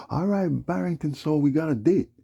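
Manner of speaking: high and loud put-on official voice; mocking clerk voice. Retelling the clerk's announcement.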